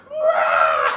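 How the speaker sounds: loud, low "rah" growl; big mock beast roar; imitated attack roar. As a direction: roar through the kick. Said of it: "and he goes".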